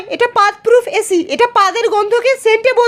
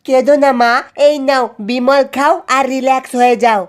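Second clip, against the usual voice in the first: much lower, deep voice soothing mother; consoling character voice; 9 semitones lower than usual. Acted. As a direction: drop low and tell mother not to cry. (নিচু, ভারী গলায় মাকে সান্ত্বনা)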